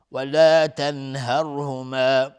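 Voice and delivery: ولا تنهرهما chanted slowly and loudly, with the ن of تنهرهما pronounced clearly before ه; clear nun. Shown as a demonstration. Teacher demonstrates izhar of nun, slow chanted recitation. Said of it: "نون ساکن بعدش های دو چشم اظهار حلقی".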